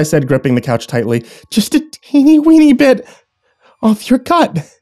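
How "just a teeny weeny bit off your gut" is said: very high sing-song wheedle; crazed wife wheedling for a bite; creepy wheedling. Acted. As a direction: coax it sweetly and madly.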